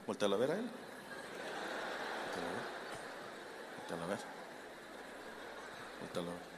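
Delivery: very soft, low repeated nudge; barely audible, pausing between repeats; hushed insistence. Shown as a demonstration. Repeated order, fading to near nothing.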